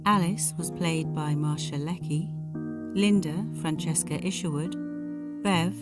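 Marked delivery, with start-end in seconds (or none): none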